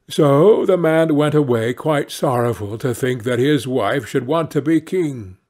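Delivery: ordinary delivery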